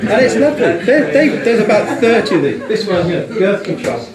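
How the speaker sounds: high and loud through laughter; bubbling over with giggles; delighted laughing chatter. Real, not acted. Laughing at chocolate names.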